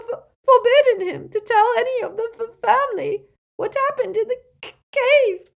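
high, loud, stammering wail; breaking into sobs. A narrator's reading. Elf forbidden to tell the family, stammering sobbing wail. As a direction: build to a sobbing, stuttering cry. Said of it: "Creature began to sob so hard".

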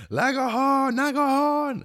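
very high garbled calling; mock Aragorn. Aragorn calling Legolas, very high silly call. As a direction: squeal the mangled name twice. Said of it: "He's like".

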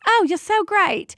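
high, gushing London English; British accent. Acted. London anesthesiologist delighted to meet a doula, British accent. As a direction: greet them delightedly in a put-on British accent. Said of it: "He was from London".

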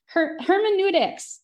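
stumble, then push out "hermeneutics" loudly; effortful pronunciation. Real, not acted. Host attempting a hard word in the teacher's introduction, "hermeneutics" pushed out high and loud. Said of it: "have a hard time with this word".

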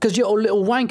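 high put-on British dad voice; hammed-up accented father; bossy dad. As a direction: order the kid outside in a silly accent. Skit: father voice.

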